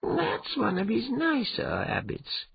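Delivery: very high, slow cat character voice; worried cat companion. Acted. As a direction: answer high and drawn out, as the cat. Cat companion's dry warning reply.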